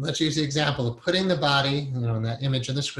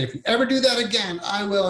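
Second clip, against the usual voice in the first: put-on higher, fast threatening yell at a child; mock scream at kid; 6 semitones higher than usual. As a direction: scream the threat at your kid, pitched up high. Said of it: "and you screamed".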